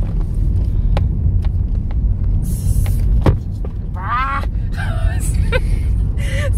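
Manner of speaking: laughing. Real, not acted. Laughing at own bloopers.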